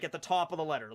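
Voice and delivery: ordinary talk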